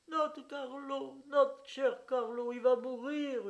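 high, loud wailing, the name drawn out slowly; howling mother. Acted. Old mother howling over her dying Carlo, high slow wail. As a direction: howl in grief for the crocodile. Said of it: "hurlait la mère".

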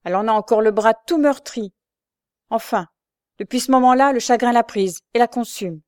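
heated denunciation; vehement. A narrator's reading. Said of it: "avec une véhémence".

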